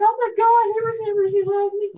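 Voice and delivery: hammed-up retold joy, almost tearful; thrilled. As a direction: swoon with sudden delight. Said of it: "I was like".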